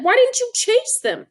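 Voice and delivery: put-on high, incredulous boy's voice; high-pitched cousin asking in disbelief; cousin's voice. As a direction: ask it like a baffled older cousin.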